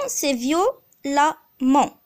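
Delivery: violemment drawn out slowly; slow demo. Shown as a demonstration. Teacher modelling the adverb, slow. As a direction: say it slowly so they hear the a. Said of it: "Et violent".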